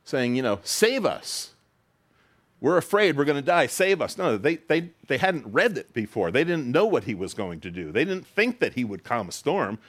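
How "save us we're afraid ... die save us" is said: panicked disciples. Retold or imitated. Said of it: "they wake him up saying".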